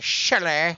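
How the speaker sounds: "Shelley" with a put-on "eh" ending; teasing mispronounced "Shelley", final vowel open; comic "Shelleh" pronunciation. Shown as a demonstration. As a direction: say "Shelley" as "Shel-LEH". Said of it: "the only way I will always say it".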